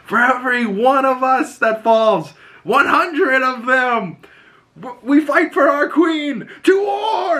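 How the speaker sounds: high-pitched mock battle speech, building to a cry; imitated rallying cry. Prince hyping up the queen's troops, imitated rallying yell. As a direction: imitate a commander firing up troops, voice pushed high. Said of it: "hypes up her troops".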